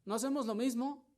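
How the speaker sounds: high, fast, loud challenge; loud preaching cry. Acted. Pastor rebuking the congregation, high loud cry. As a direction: fling the question at them.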